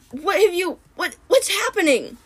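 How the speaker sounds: high, strained cry of alarm; gasping in sudden pain; pained panic. A narrator's reading. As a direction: voice jumping up in panic.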